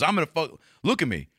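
high, cartoonish bully cop voice; mock a cocky roided-up officer; aggressive cop. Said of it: "He goes".

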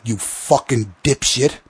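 ordinary delivery

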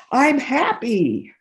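high, drawn-out imitation of a happy kid; happy child. Little kid picturing finished homework, imitated as happy. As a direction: play a delighted little kid, slowly.

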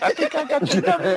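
chuckling through it; laughing while talking. Retold or imitated. First take.

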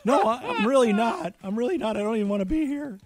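mild imitation of a teary waitress. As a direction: beg off with a tearful catch. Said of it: "my wife goes".

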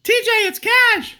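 squeaky high falsetto, played as his real voice; falsetto voice reveal. Cash reveals his real voice to TJ in a squeaky high falsetto. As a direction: reveal a tiny high voice with total sincerity. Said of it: "why does your voice sound like that".

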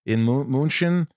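German street names, German accent; German pronunciation. Shown as a demonstration. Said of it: "doing this accent constantly".

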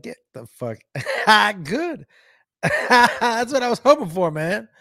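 crowing through laughs; gleeful laughing retort. Retold or imitated. Gleefully mocking trolls who leave.

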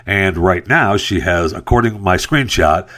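ordinary talk, nothing marked

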